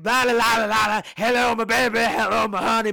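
loud, high, manic babbling greeting; loud mock showman's greeting; mock singsong babbling. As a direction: belt out a crazed hello, high and loud. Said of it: "And he's like".

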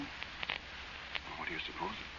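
breathy frightened whisper; barely voiced, afraid of being heard; hushed whisper in the dark. Frightened at the well at night.

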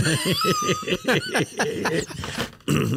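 real helpless laugh; shrieking laugh. Real, not acted. Big laugh at the joke.

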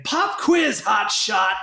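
high, shouted movie-villain taunt; mock villain shout. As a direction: throw it out like a hammy action villain. Quoting Hopper's villain line from Speed.

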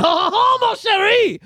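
high, loud French exclamation with laughter; mock Frenchman. Host playing French people brushing off excuses, hammed-up and loud. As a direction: ham up a scornful French cry.

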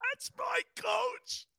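tearful, very high-pitched wail; hammed-up crying in defence of the coach. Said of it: "um, a T O. You ready?".

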